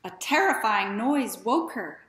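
usual speaking voice

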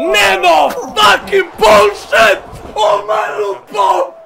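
outraged screaming; angry yelling. Real, not acted. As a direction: yell in disbelief. Raging gamer loses round.

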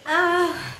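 high, tearful exclamation; real tearful outburst; crying. Real, not acted. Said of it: "I'm crying".

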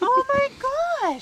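excited. Real, not acted. Delighted by pillow holder.

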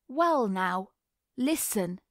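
lazy drawled opening. A narrator's reading. Stephen answering a taunt about riding, drawling. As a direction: draw the words out in a leisurely drawl. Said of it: "he drawled".

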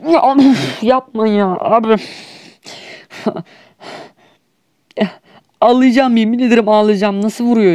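high, pleading near-tears whine; new gamer persona whining he'll cry; whiny crybaby gamer. Pretend. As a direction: whimper like a sore loser kid. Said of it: "yeni bir oyuncu".

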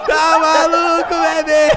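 excited. Real, not acted. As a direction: gloat in delight. Celebrating the goal.

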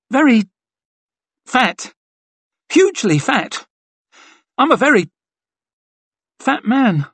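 very high, halting squeaky voice; high-pitched, embarrassed self-deprecation; floundering squeak. A narrator's reading. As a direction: squeak each bit out after an awkward pause. Embarrassed soldier babbles into silence.

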